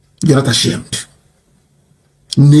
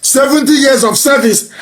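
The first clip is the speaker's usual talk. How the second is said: mock begging; 9 semitones higher than usual. Mocking the elders begging to the president. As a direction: ham up a wailing beggar. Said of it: "They are now begging".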